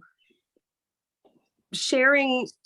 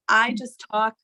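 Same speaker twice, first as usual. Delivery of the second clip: normal talking voice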